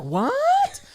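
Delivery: high, loud shocked yelp; shocked what. Retold or imitated. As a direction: burst out in disbelief. His shocked reaction, retold.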